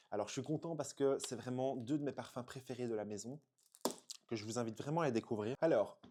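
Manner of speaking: ordinary delivery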